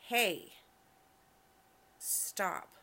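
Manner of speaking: loud, abrupt call to halt; sharp command. Acted. Girlfriend halts her ranting partner, sharp and loud. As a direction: cut them off loudly.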